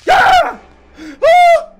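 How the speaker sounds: two high loud shrieks; real panicked shrieks; startled screams. Real, not acted. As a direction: scream twice in fright. Jump scare.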